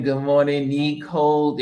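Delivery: ordinary talk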